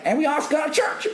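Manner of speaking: high, fast Southern mom voice; Southern accent. Retold or imitated. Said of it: "a southern accent".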